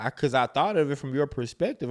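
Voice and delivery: usual speaking voice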